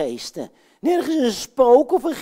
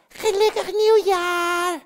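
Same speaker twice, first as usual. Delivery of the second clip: high, slow, drawn-out ghost wail; a hint of a ghost's wail; ghostly voice; 8 semitones higher, slower than usual. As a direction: moan the greeting like a spook. Ghost voice greeting.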